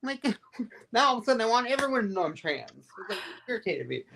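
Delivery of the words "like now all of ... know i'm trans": much higher, outraged retelling of her own reply; high-pitched mock indignation, laughing through the last line; indignant exclamation. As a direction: squeal it like you can't believe they missed it. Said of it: "I'm like".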